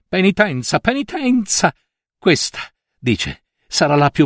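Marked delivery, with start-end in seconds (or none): 0.1-0.8 Penitenza said higher, with a bitter fall; mock repetition of the order; scornful echo. A narrator's reading. Aunt mocks the priest's order. 0.8-1.7 penitenza! flung much higher, scandalized; outraged repeat, much higher; outraged cry. A narrator's reading. Aunt mocks the priest's order.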